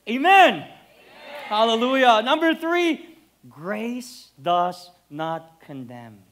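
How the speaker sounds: loud, fervent preacher's cry; a pastor whipping up the crowd with loud praise; preaching. Acted. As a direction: lift the congregation with a loud call. Preaching at full force.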